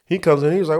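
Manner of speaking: ordinary delivery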